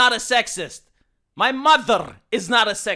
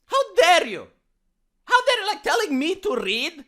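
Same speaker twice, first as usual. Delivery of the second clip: high, indignant how dare you; indignant outrage at a critic; outraged; 6 semitones higher than usual. Real, not acted. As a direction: rear up in offended disbelief. Outraged at being told to read.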